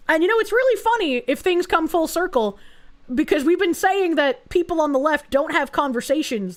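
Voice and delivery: usual speaking voice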